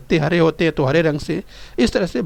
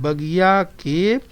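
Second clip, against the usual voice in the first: slow, split after के; slow, careful reading for the class; much slower than usual. Shown as a demonstration. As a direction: read each word with care. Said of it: "वाचन बराबर करते हैं".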